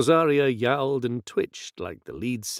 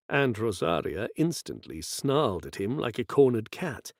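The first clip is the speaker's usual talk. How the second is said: ordinary delivery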